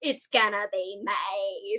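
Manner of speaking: slow, exaggerated boy band accent turning "me" into "May"; low, soft, drawn-out boy band accent. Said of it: "like, a little accent".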